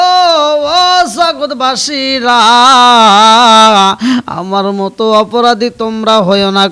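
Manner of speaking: loud chanted wail to the world; tearful lament. Acted. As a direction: act out the weeping youth in preacher's singsong. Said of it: "আরে যুবক কান্দে আর কয়".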